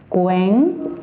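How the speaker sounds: "cuéntamelo" as isolated syllables with long pauses; Silbentrennung. Shown as a demonstration. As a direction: sound out "cuéntamelo" as cuen... ta... me... lo. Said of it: "la separación sería".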